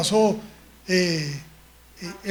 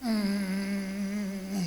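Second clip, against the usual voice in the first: ordinary talk, nothing marked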